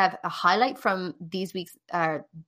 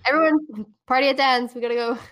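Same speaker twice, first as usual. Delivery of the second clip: excited call; 4 semitones higher, 6 dB louder than usual. Acted. Calling everyone to party.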